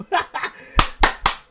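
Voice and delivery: airy, high laugh of disbelief; giddy laugh at the news; breathless giddy laugh. Real, not acted. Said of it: "I'm so surprised".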